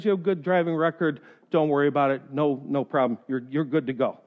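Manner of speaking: low, soft easygoing judge; a lightly voiced kindly judge letting him off; reassuring judge.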